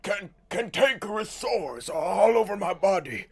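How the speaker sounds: lightly voiced lecherous old man; a hint of a dirty old lord. Mocking the voice actor, lightly played old lech. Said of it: "knowing it's just".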